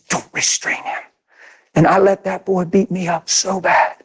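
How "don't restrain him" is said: whispered, soft divine instruction; whispered words of Jesus; inner voice whisper. Retold or imitated. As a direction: breathe it like a voice in the heart. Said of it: "Jesus spoke to my heart".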